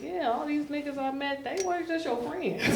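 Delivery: high-pitched mock realization; mock knowing accusation. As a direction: pitch it up, teasing mom with the truth. Confronting mother in retold scene.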